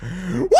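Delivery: rising squealed mock baby cry; imitated baby wail. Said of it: "That baby is still like".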